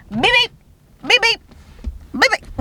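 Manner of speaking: high, bright car beeps; car voice beeping. Pretend. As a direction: voice the car saying goodbye. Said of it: "I think it would say beep".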